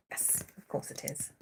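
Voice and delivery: soft unvoiced aside; whispering. Real, not acted. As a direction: whisper it drily. Muttered at cat.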